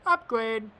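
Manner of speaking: sudden loud exclaim; loud happy call; excited. Real, not acted. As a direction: cheer the upgrade. Excited game reward.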